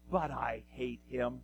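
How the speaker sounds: low, soft aside of dislike; acted loathing. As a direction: drop down low and quiet to confess hatred. Ahab voicing hatred of prophet.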